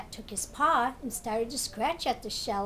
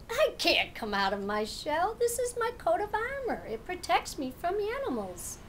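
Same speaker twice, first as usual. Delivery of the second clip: turtle voice climbing much higher on armor; earnest turtle; 7 semitones higher than usual. Acted. Turtle tells the cat about his shell, earnest. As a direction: proudly explain the shell.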